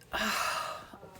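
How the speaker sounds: airy, voiceless sigh; long moved exhale; emotional sigh. Real, not acted. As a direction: breathe out, overcome by the moment. Moved by remembering the salute.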